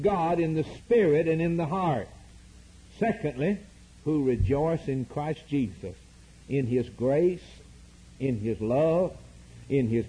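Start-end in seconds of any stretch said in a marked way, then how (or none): none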